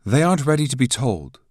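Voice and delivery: sharply. A narrator's reading. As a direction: state it sharply. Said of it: "states sharply".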